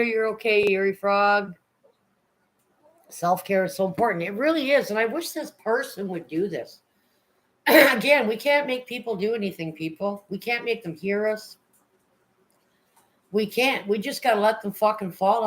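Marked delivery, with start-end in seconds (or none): none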